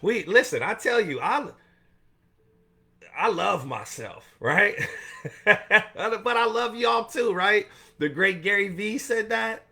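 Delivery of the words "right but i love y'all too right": laughing. Real, not acted. Laughing to his viewers after joking he loves himself. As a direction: chuckle through it, slow and light.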